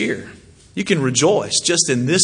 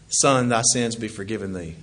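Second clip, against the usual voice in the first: soft low Jesus; 6 semitones lower, 6 dB quieter than usual. Retold or imitated.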